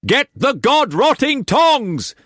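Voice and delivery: high, slow, loud fury; furious shout. A narrator's reading. Cullum shouting at Tess for the tongs, furious. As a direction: spit the order out. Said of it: "Cullum shouted".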